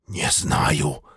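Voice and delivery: low, breathless whispered answer; hushed scared reply; whispering. A narrator's reading. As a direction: whisper back nervously. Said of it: "ответил он тоже шёпотом".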